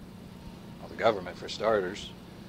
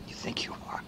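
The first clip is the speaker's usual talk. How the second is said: soft, sly whispered question; taunting whisper; 12 dB quieter than usual. Acted. Taunting the audience about Exhibit B, in a whisper. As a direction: tease them under your breath.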